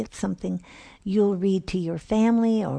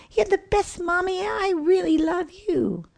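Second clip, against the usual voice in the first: put-on much higher small child voice, scared; fretful little child; 13 semitones higher than usual. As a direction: whimper it like a tiny kid. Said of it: "the mom smiled".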